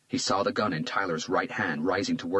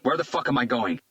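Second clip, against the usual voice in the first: urgent shout; 5 semitones higher, 4 dB louder than usual. A narrator's reading. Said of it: "Hunter shouted".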